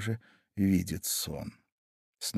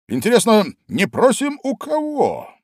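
high puzzled king; 11 semitones higher, 6 dB louder than usual. A narrator's reading. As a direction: puzzle it out, pitched up. King puzzles over verse.

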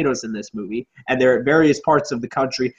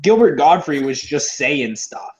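ordinary delivery

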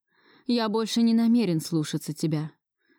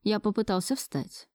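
plain reading voice

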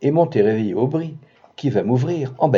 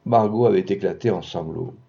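ordinary delivery, nothing marked

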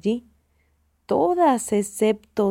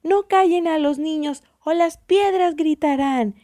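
high, raised-voice retort; crowd protesting; 6 semitones higher, 4 dB louder than usual. A narrator's reading. The people answer the angry men, protesting. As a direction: call it back high and emphatically. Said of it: "decía la gente".

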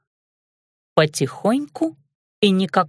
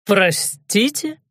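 cracking, startled, drawn-out question; much slower than usual. Acted. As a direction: voice breaks on the word. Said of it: "возглас получился ломким".

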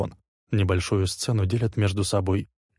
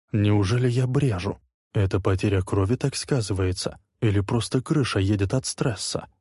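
ordinary delivery, nothing marked

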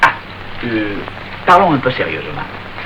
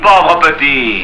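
restrained taunt; much slower than usual. Acted. Panisse nose to nose with Marius, restrained taunt. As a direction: draw it out with contained menace.

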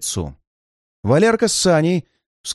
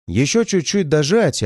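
plain reading voice

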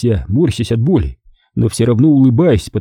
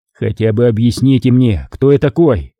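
usual speaking voice

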